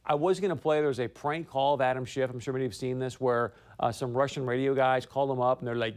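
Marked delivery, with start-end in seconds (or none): none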